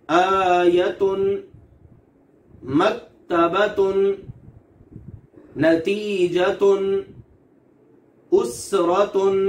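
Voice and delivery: each word drawn out slowly with light, thin ta; thin ta examples. Shown as a demonstration. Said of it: "ये सब ता की मिसालें हैं".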